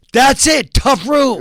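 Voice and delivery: loud eureka shout; excited shout. Retold or imitated. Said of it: "And I go".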